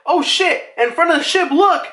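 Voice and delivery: high, urgent mock alarm; high-pitched warning cry; alarmed lookout. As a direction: imitate a crewmate spotting trouble. Crew spotting enemies, alarm acted out.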